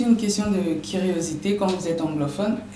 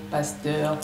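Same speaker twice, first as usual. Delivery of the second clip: slow and louder, the full title rolled out; grand title announcement; 6 dB louder, slower than usual. Acted. Host greeting the pastor on air with a grand announcer voice. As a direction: announce him like a dignitary.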